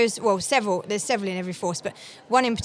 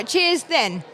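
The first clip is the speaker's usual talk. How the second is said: country Devon "Cheers, then", higher; Devonshire accent; 6 semitones higher than usual. Shown as a demonstration. Guest demonstrates Devon goodbye to American hosts. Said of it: "It's very country".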